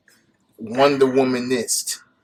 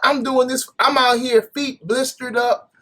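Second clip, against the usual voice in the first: mock suffering self; 9 semitones higher than usual. Husband mocking his own sore-feet suffering. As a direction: ham up the martyr, pitched up. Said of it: "I'm like".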